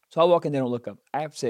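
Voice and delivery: normal talking voice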